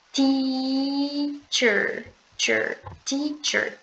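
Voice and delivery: "teacher" with a long ee sound, slow. Shown as a demonstration. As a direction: stretch the ee of "teacher". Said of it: "esse E A vai ter som de I". ("teacher" com som de I alongado)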